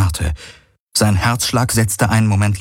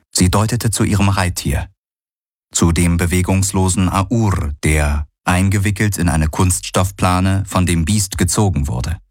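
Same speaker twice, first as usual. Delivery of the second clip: ordinary delivery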